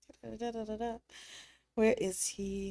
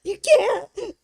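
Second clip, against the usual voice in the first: high, loud mock wail; a melodramatic, tearful plea against the inner demon; hammed-up anguished cry; 11 dB louder, slower than usual. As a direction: shriek in hammed-up despair. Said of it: "Tell him you'll put him".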